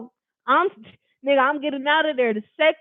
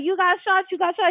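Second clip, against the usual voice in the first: higher, pressing retold repetition; urgent repeating; 7 semitones higher than usual. Boyfriend repeating she got shot, higher urgent retelling. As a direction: drive the news home to her.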